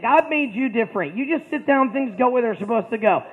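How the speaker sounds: ordinary talk, nothing marked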